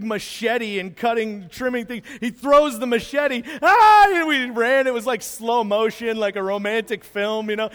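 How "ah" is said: high, loud yelp of joy; excited yelp. Retold or imitated. Man cries out as he runs to the family, a loud happy yelp. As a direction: burst out with a happy yell. Machete thrown, running to greet.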